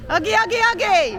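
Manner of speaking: loud chant under the bridge. Real, not acted. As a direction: shout it out to hear the echo. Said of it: "you have to do echoes under here".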